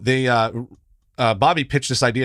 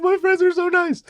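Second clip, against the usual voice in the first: mock blubbering. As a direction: blubber it out through fake tears. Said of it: "I was just ugly crying".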